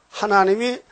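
usual speaking voice

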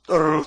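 rolled, trembling "tteo-reu-reu" noise; imitated quavering voice. Preacher describes a woman's singing voice, imitating its tremble. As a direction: mimic a singer's heavy vibrato as a trill. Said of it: "또 떠는 목소리".

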